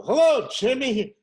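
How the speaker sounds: loud, high, cheery hello; hammed-up greeting. First day at the gate, a loud, cheery hello to the guard. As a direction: boom it out like a big shot. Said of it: "I go".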